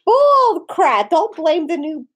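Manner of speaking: high, loud burst of disbelief; loud protest. Real, not acted. Objecting to blame on the new family cat, loud protest.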